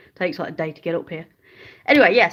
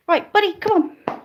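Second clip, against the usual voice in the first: high, louder call to the dog; pitched-up dog coaxing; 10 semitones higher, 4 dB louder than usual. Real, not acted. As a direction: coax the dog to come along.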